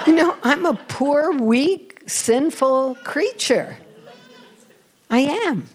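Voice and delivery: high-pitched, disbelieving self-deprecation; incredulous protest. Retold or imitated. Speaker retelling her reply to the shop worker, incredulous protest. Acted protest at being called shining.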